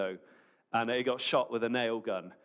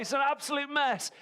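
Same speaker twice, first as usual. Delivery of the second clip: much higher, mock shocked "absolute mess" with the fall on "mess"; shocked dismay; 14 semitones higher than usual. Newcomer seeing the state of church, mock shocked cry. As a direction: gasp it out like a stunned churchgoer. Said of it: "you're like".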